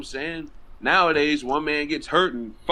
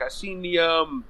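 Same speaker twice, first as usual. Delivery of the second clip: ordinary talk, nothing marked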